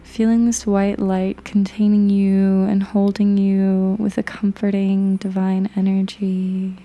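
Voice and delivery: guided meditation. Acted.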